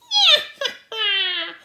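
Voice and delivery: high, loud cackling laugh; witch cackle. A narrator's reading. Witch cackle after teasing.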